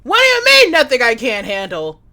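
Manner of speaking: high, loud flustered protest; flustered outrage. Real, not acted. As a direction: squeal in disbelief.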